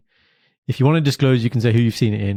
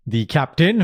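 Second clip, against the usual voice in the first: ordinary delivery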